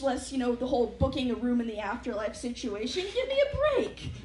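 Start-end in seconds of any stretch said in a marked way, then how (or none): 3.1-4.1 high-pitched laughing give me a break; high exasperated whine. Real, not acted. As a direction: whine it in disbelief. Mocking billboard exasperation.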